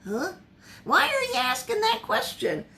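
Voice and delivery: high puzzled pitch jump; bewildered huh. Retold or imitated. Peter taken aback by Jesus asking, high puzzled voice. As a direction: caught off guard by the question. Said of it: "I'd have probably gone".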